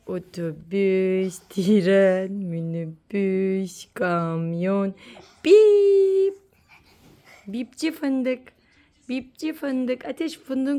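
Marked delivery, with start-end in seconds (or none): 0.1-4.9 very slow, low build-up; playful countdown. Pretend. Nose-beep game on the dog. 5.4-6.4 high, loud, long beep; playful beep. Pretend. Beep ending the game.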